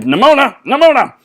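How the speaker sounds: high, loud calling her name. Acted. Ballister looking for Nimona, calling out loudly. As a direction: call across the room.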